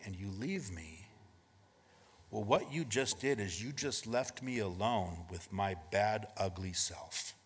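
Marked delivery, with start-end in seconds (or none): none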